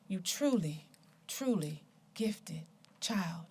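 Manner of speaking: soft poem ending. Acted. Poet closing her poem to the gifted child, soft and slow. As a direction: let each word settle quietly.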